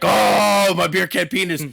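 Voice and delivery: hammy Hulk grieving over Elizabeth; Hulk Hogan impression. Hulk sees Elizabeth hurt, hammed-up wail. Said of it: "he's like".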